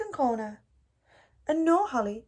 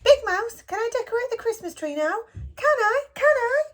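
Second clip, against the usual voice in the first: high, eager little mouse pleading; 10 semitones higher than usual. A narrator's reading. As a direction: beg like an excited small child. Little Mouse voiced begging.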